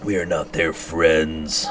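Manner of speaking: slow, deep, stretched-out declaration; cold character voice. Acted. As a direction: lay every word down slowly and coldly. Game line voiced in character.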